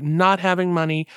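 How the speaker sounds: ordinary talk, nothing marked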